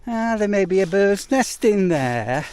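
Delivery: high, slow fussy know-it-all voice; whiny warning from a nitpicky viewer; mock commenter. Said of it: "before somebody starts going".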